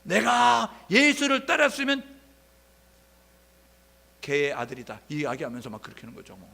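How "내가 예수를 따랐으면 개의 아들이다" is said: loud, high, slow vow that drops at the end; cursing oath. Retold or imitated. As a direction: swear it with a curse. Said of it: "저주까지 해요". (저주하듯 크게 맹세하는 베드로)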